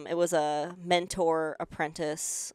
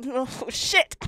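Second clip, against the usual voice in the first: retold realization; 8 semitones higher, 4 dB louder than usual. Realising her mistake.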